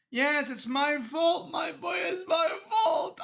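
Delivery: slow, rising hammed-up lament; mock remorseful cry. Cop playing the guilty father to the killer, mock lament. Cop's tearful confession to killer.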